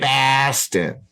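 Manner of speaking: put-on a light Boston accent. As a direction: repeat the name in a hammed Boston accent. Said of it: "That's my Boston accent".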